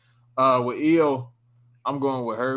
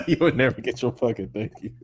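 laughing mock repeat.

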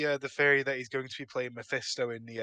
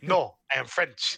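fake French accent. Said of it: "is faking to be French".

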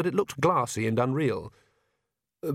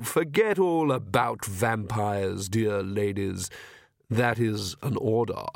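slow, controlled vampire command; unhurried, commanding aristocrat; suave vampire; slower than usual. Acted. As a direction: instruct them smoothly and slowly, as if hypnotising. Vlad exerts mind control.

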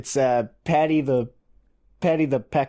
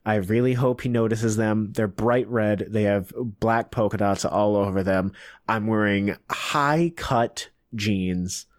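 internal monologue; 6 semitones lower than usual. Acted. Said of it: "I'm gonna be the internal monologue".

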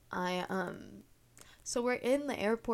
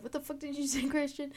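imitating angry boyfriend; 7 semitones higher, faster than usual. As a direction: redo the offended boyfriend, pitched up.

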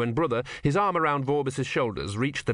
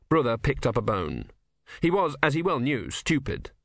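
ordinary delivery

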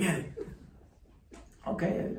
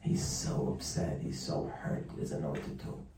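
whispered, sinking lower; hushed and crushed; 10 semitones lower, 6 dB quieter than usual. A narrator's reading. Rabbi describes the hurt man, hushed. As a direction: tell his misery under your breath.

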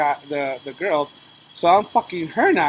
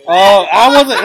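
ordinary talk, nothing marked

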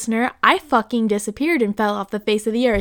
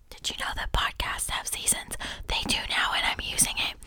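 whispering; 12 dB quieter than usual. Real, not acted. Whispered aside to listeners.

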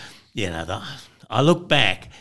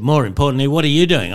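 louder, higher comeback question; retold pointed retort; 6 semitones higher, 8 dB louder, faster than usual. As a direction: throw the question back, raised and emphatic. Policeman retorts in retold exchange.

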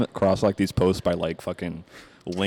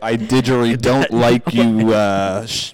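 loud, slow, hammed-up Australian; Australian accent; 4 semitones higher, 7 dB louder, slower than usual. Guest asked to play an Australian, broad mock Australian accent. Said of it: "Go, you do Australian accent".

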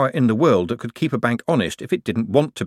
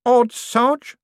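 innocently; 3 dB louder than usual. A narrator's reading. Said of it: "said Nobby innocently".

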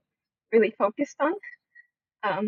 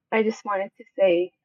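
usual speaking voice

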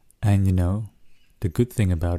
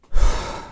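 audible exhale; 6 dB louder than usual. Shown as a demonstration. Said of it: "And do this three more times".

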